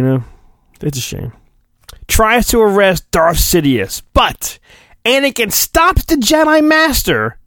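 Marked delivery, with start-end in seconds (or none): none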